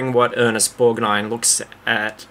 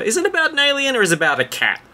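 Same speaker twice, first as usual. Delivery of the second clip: lightly voiced author, higher and quick; a hint of a puzzled writer, pitched up and hurried; imagined dithering author; 13 semitones higher, much faster than usual. Writer wondering aloud what his book is about, lightly voiced, high and quick. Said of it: "because he said".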